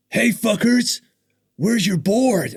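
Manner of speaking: loud, high retold challenge; mock confrontational shout. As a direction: imitate someone yelling over at strangers. Phelps calling out to them.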